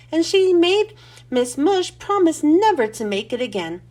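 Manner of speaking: ordinary delivery, nothing marked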